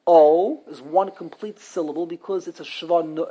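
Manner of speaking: ordinary talk, nothing marked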